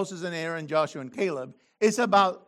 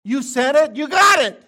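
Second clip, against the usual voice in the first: loud, raised "you got it", half shouted; God's stern voice; 7 semitones higher, 8 dB louder than usual. Acted. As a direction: hand down the verdict loudly. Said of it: "and the Lord said".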